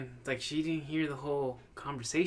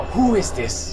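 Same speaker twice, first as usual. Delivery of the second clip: loud, demanding Liam Neeson growl; hammed-up action hero demanding an answer; tough Liam Neeson; 6 semitones higher, 11 dB louder, slower than usual. Imitating Liam Neeson answering the scam caller. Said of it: "I literally go".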